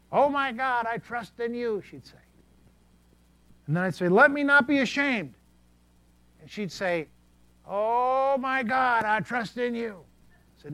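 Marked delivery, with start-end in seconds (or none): 0.1-1.8 lightly voiced old lady. Gladys reciting the verse. 4.1-5.3 high, loud reading of the next line; loud verse reading. Retold or imitated. Pastor reading to deaf Gladys, loud and high. 7.6-10.0 lightly voiced old lady. Gladys repeating her verse.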